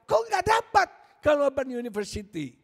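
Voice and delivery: high, loud, indignant shouting of the niece's complaint; frustrated outburst. Retold or imitated. Preacher voicing the rejected niece's anger, high and loud. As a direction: rant as if you were cheated out of the scholarship. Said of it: "bayangin kecewanya dia".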